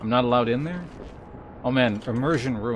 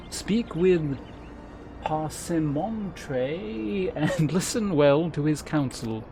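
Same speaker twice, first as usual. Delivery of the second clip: measured, ceremonious quest-giver voice; stately guild elder; 5 semitones higher than usual. Acted. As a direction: instruct a new adventurer like a gracious mentor.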